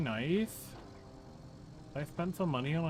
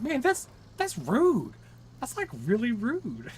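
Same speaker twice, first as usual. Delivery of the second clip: higher, louder mock-indignant protest; mock offended friends; 7 semitones higher, 6 dB louder than usual. Streamer quoting friends' outrage, in a hammed-up high indignant voice. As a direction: play it up like offended buddies. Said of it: "T T and Wildman were like".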